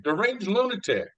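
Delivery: high-pitched mock insult; Donald Trump impression. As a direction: toss out the insult with gusto. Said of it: "hi, this is Donald Trump".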